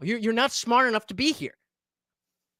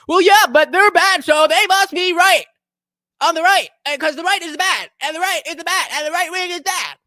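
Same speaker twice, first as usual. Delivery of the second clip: higher, louder mock reasoning; sarcastic parody; 6 semitones higher, 5 dB louder than usual. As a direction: ham up the silly logic. Sarcastically parroting chatter's logic.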